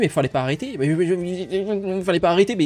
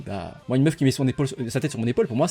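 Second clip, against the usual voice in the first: usual speaking voice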